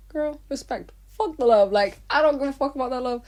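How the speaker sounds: loud, high, defiant retort; emphatic retort. Retold or imitated. Answering lady: respect over love.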